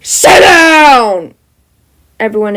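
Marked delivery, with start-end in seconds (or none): 0.0-1.3 very loud high shout; yell. A narrator's reading. Aizawa yelling at noisy students, loud shout. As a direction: bellow at the class. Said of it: "takes a deep breath and yells".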